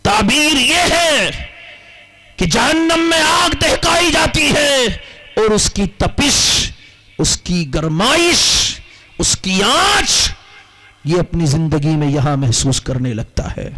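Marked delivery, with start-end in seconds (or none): 0.0-4.8 fiery preaching. Acted. Preaching about hellfire. 5.4-10.3 slow emphatic list. Acted. Slow listing of heat words.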